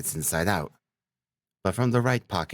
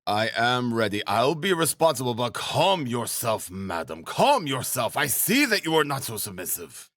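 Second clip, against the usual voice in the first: lightly voiced flustered gentleman, pitch lifted on the commands; flustered man placating; 5 semitones higher than usual. A narrator's reading. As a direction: try to calm a frantic woman, insistently. Luzhin answers the frenzied woman.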